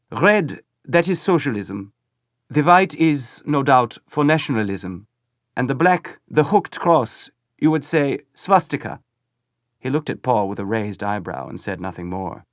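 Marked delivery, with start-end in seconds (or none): 0.1-9.0 put-on high, loud enthusiastic German-accented boy. A narrator's reading. Boy eagerly explains the flag's colours to Paul, enthusiastic. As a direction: proudly explain each colour. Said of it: "he said enthusiastically".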